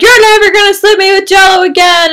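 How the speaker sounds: big excited yell from the ladder; shout. Acted. Cameron hanging from a helicopter ladder yells at the villain, full shout.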